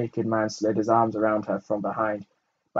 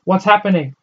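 shouting; 9 semitones higher, 10 dB louder than usual. A narrator's reading. Arya shouting running.

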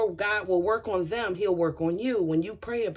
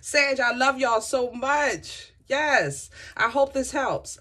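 higher, louder, slow gushing goodbye; gushing sign-off; 7 semitones higher, 4 dB louder, slower than usual. Real, not acted. Reader bidding Sag viewers goodbye, gushing high and loud. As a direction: pour the love out, slowly.

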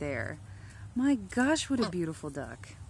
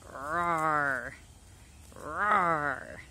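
dinosaur roar; 2 semitones lower than usual. Pretend. Voicing the attack duck as a mock dinosaur roar. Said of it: "put some dinosaur sound effects".

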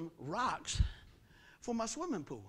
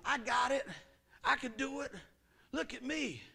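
higher, louder show-off claims; mock bravado; 6 semitones higher, 5 dB louder than usual. Overloaded believer to onlookers, higher pushed mock bravado. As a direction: show off, straining a little. Imitating overconfident person taking on load.

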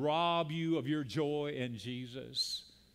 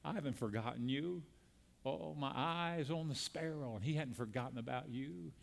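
soft, low and tender reassurance; Jesus speaking tenderly; 5 semitones lower, 8 dB quieter than usual. Retold or imitated. Jesus comforting a struggling believer, softly and tenderly. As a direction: gently comfort the suffering listener. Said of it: "He'd say to you today".

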